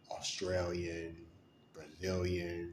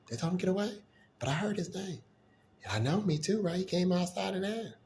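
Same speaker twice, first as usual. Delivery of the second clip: much higher, louder gossiping neighbor; excited gossip; 10 semitones higher, 5 dB louder than usual. Retold or imitated. Neighbors gossiping about him in the hallway, high and excited. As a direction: chatter like a nosy neighbor.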